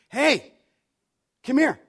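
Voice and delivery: loud, high summons; acted shout across to someone; calling out. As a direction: call him over sharply. Pastor calls man over, third week.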